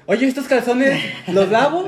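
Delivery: high, loud mom voice calling into the room; intruding mom. Acted. Mom interrupts her son's Xbox chat, loud and high. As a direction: call out loudly like a mom barging in. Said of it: "abre el cuarto su mamá y ya de que".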